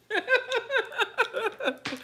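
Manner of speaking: high-pitched cackle; high laugh. Real, not acted. Laughing after mama imitation.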